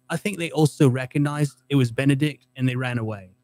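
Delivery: put-on British-accented eyewitness quote. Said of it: "read it in a British accent".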